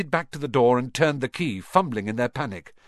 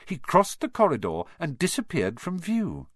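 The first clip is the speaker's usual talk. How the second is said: ordinary delivery, nothing marked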